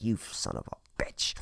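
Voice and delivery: hushed, unvoiced cursing under the breath; disgusted mutter. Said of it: "disgusted, like".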